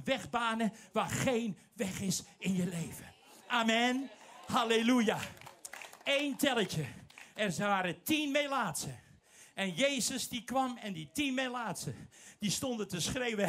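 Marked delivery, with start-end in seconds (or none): none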